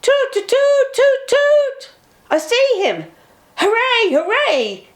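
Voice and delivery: high, loud excited cheer. A narrator's reading. Bertie cheering on seeing Edward, high and loud. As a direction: cheer from the hilltop at the sight. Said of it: "he cheered".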